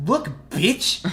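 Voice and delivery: high, loud attention-grabber; retold furious shout. Teen rounds on the mom in front of everyone, shouting. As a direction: launch into a re-enacted public tirade. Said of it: "as loud as I fucking could".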